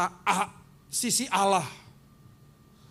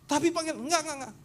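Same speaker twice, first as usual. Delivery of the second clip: 1 arguing pastor; 8 semitones higher, faster than usual. Retold or imitated. Acted argument.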